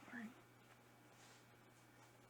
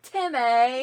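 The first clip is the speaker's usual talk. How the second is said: fake British shout; 5 semitones higher, 12 dB louder than usual. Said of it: "we were like".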